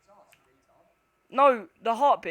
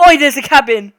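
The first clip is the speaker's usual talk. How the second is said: loud call; 2 semitones higher, 15 dB louder than usual. Real, not acted. Spotting cabin in horror game.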